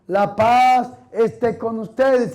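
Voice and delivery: slow, measured liturgical greeting; priestly greeting. Acted. Priest showing how clergy greet the people, slow and liturgical. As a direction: stretch out the blessing as at Mass. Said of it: "Ese es el saludo que damos los curas al pueblo".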